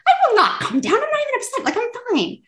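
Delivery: high, indignant protest; imagined adult snapping back at a partner, high and indignant; defensive denial. Retold or imitated. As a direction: insist you're fine while clearly not. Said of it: "It's like".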